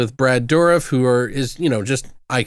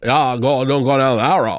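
put-on Pet Sematary neighbor accent. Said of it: "accent from the neighbor in Pet Sematary".

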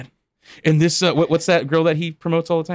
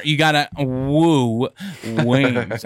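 imitating Flair; 3 semitones lower, much slower than usual. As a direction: stretch every word like a confused wrestler.

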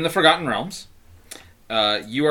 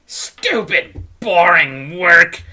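muttering; 7 dB louder, much slower than usual. A narrator's reading. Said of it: "she mutters under her breath".

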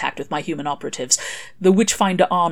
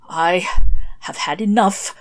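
slow, worn-out croak; 6 dB louder, slower than usual. Acted. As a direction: croak it out slowly. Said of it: "he croaked out".

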